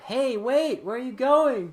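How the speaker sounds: high, loud call after someone; lightly voiced wife calling out; mock worried wife shouting after him. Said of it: "and the wife's like".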